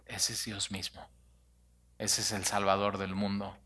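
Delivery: unvoiced hush, then softly spoken; hushed whisper. Real, not acted. Preacher to congregation, in an awed whisper. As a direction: whisper it, almost breathless with awe. Awed aside about the Savior.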